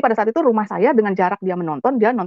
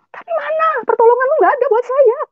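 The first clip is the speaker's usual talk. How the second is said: very high, strained, sobbing accusation; tearful angry rant; 4 dB louder than usual. Retold or imitated. Retelling how she railed at God on the road, very high and tearful. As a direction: scream the question at heaven through tears. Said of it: "Pokoknya saya ngoceh, saya marah".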